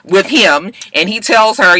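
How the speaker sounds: ordinary talk, nothing marked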